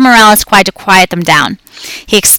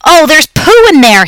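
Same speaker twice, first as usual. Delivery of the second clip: put-on higher, louder disgusted kid shriek; screaming child; 8 semitones higher, 5 dB louder than usual. A narrator's reading. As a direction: shriek it like a grossed-out boy. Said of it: "he screamed".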